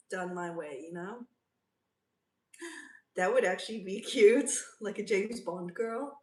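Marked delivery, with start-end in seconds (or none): 3.2-6.2 much louder, laughing mid-line. Real, not acted. Streamer laughing at viewer's Bond girl idea. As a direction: giggle through the word cute.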